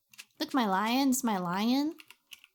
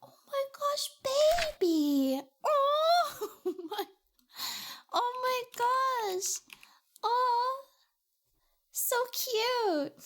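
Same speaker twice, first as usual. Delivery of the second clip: high excited squeal; 13 semitones higher, slower than usual. Real, not acted. Gushing over cute pet.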